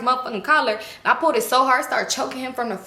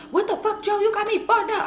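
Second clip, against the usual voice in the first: retold screaming; 11 semitones higher than usual. Furious woman to her man, retold mock scream. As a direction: reenact screaming with pitch, not volume. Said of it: "I'm just screaming at this point".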